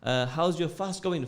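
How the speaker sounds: ordinary delivery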